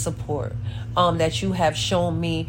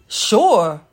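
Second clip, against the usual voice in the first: eager; 6 dB louder than usual. Retold or imitated. Eagerly accepting help.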